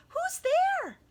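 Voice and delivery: very high, loud frightened squeak; squeaky mouse cry. Acted. Mouse startled by eyes in the den, squeaking. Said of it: "Mouse cries".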